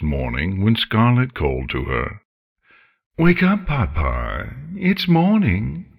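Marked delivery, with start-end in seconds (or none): 3.2-5.9 higher, lilting wake-up call; chipper dragon. Acted. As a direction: sing it out cheerily, rising high at the end. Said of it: "Scarlet seemed uncharacteristically chipper that morning".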